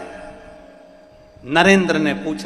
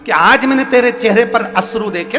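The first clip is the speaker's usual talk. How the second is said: higher-pitched, lilting motherly remonstrance; voicing a divine mother's astonished concern; goddess questioning her devotee; 10 semitones higher, 6 dB louder than usual. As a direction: raise the voice into a pleading, wondering reproach.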